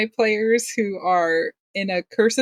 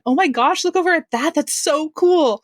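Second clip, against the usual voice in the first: put-on higher, louder, thrilled young voice; excited Nixie voice; 9 semitones higher, 4 dB louder than usual. Shown as a demonstration. To the host, demoing Nixie's voice, high and excited. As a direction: show off Nixie, dazzled by everything. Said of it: "a little bit higher".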